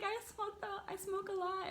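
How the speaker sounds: mocking a show-off, high and soft; a high, soft mock brag; a teasing impression of someone overclaiming; bragging smoker. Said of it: "you know how people be, like".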